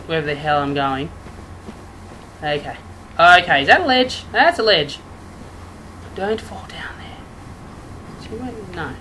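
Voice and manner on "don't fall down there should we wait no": slow, hushed self-warning; cautious whisper. Real, not acted. Gamer edging along a dark ledge, cautious whisper. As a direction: whisper it slowly and carefully.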